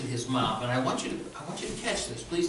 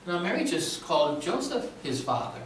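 ordinary delivery

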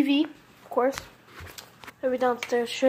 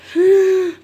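loud startled gasp, higher; sharp gasp; 6 semitones higher, 10 dB louder than usual. Real, not acted. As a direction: gasp in sudden fright. Almost dropped glass plate.

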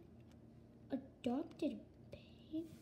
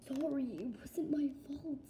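whispering; 4 dB quieter than usual. Acted. Kid whispers an excuse.

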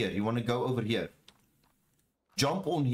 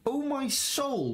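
excited; 11 semitones higher, much slower than usual. Real, not acted. Excited at big damage.

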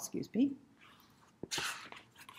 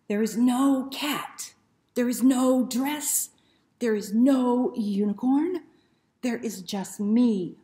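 loud, higher, emphatic denial; exasperated dog; 4 semitones higher, 9 dB louder than usual. Acted. The dog rejects the whole picture, loud and exasperated. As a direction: stamp every no.

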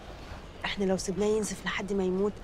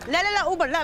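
higher and louder, rapid refusal; loud protest; 6 semitones higher, 5 dB louder than usual. Acted. Dancer loudly refusing the Uber her partner orders. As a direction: shoot the idea down hard.

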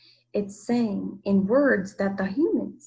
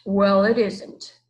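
deep monster voice; 6 dB louder, slower than usual. A narrator's reading. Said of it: "said the Ikabog in its deep voice".

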